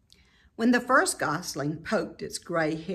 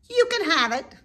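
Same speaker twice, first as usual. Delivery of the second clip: higher, louder goose voice; 12 semitones higher, 7 dB louder than usual. A narrator's reading. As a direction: toss it off dismissively. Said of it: "replied the goose".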